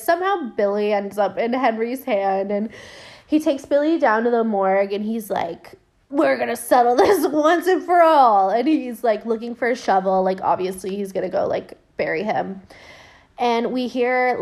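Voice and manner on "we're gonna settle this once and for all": louder, higher mock declaration; determined vow. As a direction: declare it with hammed-up resolve. Voices Henry vowing to end it.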